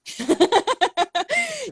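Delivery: high, bright burst of laughter; real laugh. Real, not acted. Host laughs at her own Russian accent, shy real laugh.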